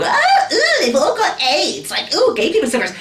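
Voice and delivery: high-pitched squeamish mimicry; mock disgust.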